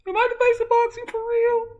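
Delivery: very high, loud put-on voice; high-pitched son character; squeaky son. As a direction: squeal it proudly to dad. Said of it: "you can talk normal now".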